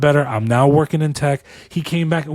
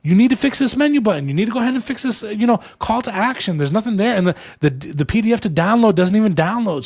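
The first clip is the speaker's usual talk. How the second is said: higher-pitched mock owner listing fixes to the developer; mock owner's demands; 6 semitones higher than usual. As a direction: parrot the fix list in a raised, put-on voice. Owner relaying website fixes to developer.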